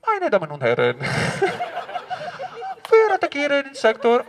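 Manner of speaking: loud station announcement over a megaphone; SBB train announcer. Acted. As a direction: announce the attack like a train conductor. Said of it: "a guy of SBB with a megaphone".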